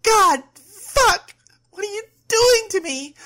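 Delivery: very high, loud anguished scream. A narrator's reading. Overwhelmed woman demanding answers of her girlfriend, screaming. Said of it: "she screamed".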